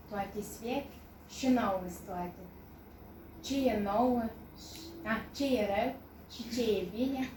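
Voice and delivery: short verse lines set apart by pauses; poetry recitation. Acted. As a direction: recite it in measured, falling phrases. (recitare de poezie)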